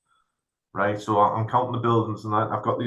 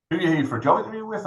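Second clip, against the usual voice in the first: much higher, quick, bright-voiced receptionist; chirpy receptionist; 8 semitones higher, faster than usual. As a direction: pitch it up like a cheery front-desk girl. Receptionist voiced in retold story.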